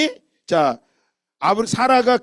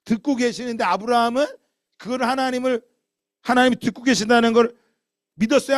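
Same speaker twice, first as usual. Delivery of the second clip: ordinary delivery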